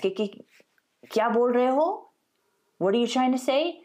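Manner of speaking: high, puzzled, stammering ask; confused. Retold or imitated. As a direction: sound lost at fast talk. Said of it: "you're talking really fast".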